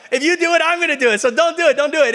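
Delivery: high, pleading mock panic; mock panicked pleading. Preacher acting out begging a queasy friend, high mock panic. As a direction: beg them not to throw up, half joking.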